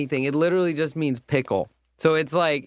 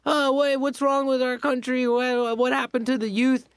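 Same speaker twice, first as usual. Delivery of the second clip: mock concern; 7 semitones higher than usual. Mocking adults complaining about youth.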